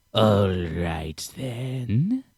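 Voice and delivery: slow, drawn-out creature reply, rising at the end; wary lizard man. Acted. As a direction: stretch each word out suspiciously. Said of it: "he very, very slowly turns around".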